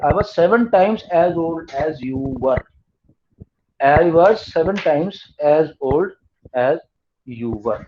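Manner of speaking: slow dictation. Shown as a demonstration. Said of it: "dictating the question to write down".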